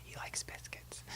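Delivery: soft whispered aside. Real, not acted. Parent telling child listener, softly whispered aside.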